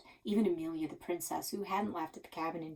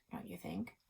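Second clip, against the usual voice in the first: whisper; 11 dB quieter than usual. Acted. Whispered aside in story.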